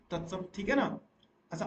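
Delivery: ordinary delivery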